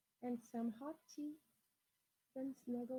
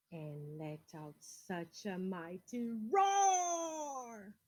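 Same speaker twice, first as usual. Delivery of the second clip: slow, swelling build to roar; dramatic build on the roar; drawn-out roar; 2 semitones lower, 5 dB louder, much slower than usual. A narrator's reading. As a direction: stretch it out and let it boom. The dinosaur roars.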